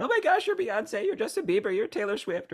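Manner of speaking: high-pitched mock fan squeal; freaking out. Hypnotized audience to their favorite celebrity, freaking out. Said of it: "they're all freaking out".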